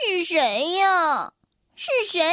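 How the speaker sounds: sad thin voice. Acted. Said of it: "这是一个悲伤而尖细的声音".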